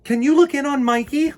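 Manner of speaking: put-on high, loud call to a child; mother calling for help with the baby, loud. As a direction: call it out from down the hall. Mommy calling from afar.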